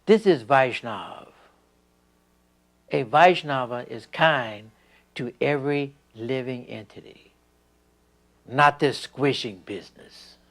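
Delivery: loud, slow, emphatic teacher's rebuke; stern quoted rebuke. Retold or imitated. Guru rebuking a disciple for squishing a bug, loud and slow. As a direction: lay down the principle firmly, word by word. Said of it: "Prabhupada said".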